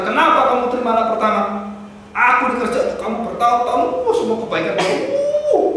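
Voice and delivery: older son protesting to his father, mock angry. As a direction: voice the older son's resentful outburst. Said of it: "anak yang kedua marah luar biasa sekali".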